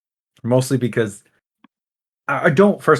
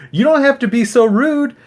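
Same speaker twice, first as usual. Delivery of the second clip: very high put-on complaint; high mocking lady voice; mock offended lady; 12 semitones higher, 3 dB louder than usual. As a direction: mimic the affronted canvasser, pitched way up. Imitates offended campaigning lady.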